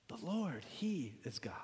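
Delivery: hushed, whispered repeat of a proclamation; awed whisper. Retold or imitated. Preacher echoes the people fallen on their faces, awed whisper. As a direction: let it fall away into a whisper.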